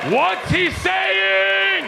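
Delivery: high, very slow shouted question; loud shout. Retold or imitated. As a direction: bellow the question slowly. Retold shout at stranger.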